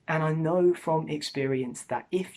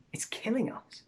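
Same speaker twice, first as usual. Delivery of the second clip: whispered; 6 semitones higher, 6 dB quieter than usual. Acted. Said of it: "sombre warning whispered".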